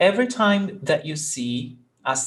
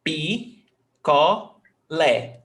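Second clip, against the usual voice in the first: pi, co, lé with a gap after each; syllable by syllable; much slower than usual. Shown as a demonstration. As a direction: break picolé into three separate beats. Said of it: "Three syllables".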